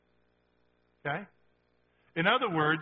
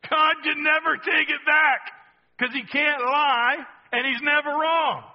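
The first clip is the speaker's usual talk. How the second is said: higher and louder, full preaching force; loud proclamation; 8 semitones higher, 6 dB louder than usual. Real, not acted. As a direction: proclaim it to the whole church.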